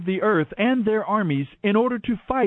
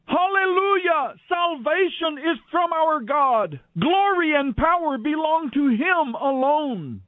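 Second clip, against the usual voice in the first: heavenly crowd shouting praise; 8 semitones higher than usual. A narrator's reading. Vast crowd in heaven shouting praise to God, high and jubilant. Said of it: "I heard the sound of a vast crowd in heaven shouting".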